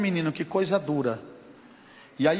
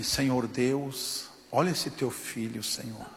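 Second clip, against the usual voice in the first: low, slow, softer plea to God; loving prayer; 4 semitones lower, 4 dB quieter than usual. Retold or imitated. Stranger praying over the injured pastor, lovingly. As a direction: pray tenderly over someone hurt. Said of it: "Fez aquela oração amorosa".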